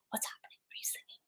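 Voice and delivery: whispered little squirrel asking; tiny whispered critter wondering. Retold or imitated. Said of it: "he's like".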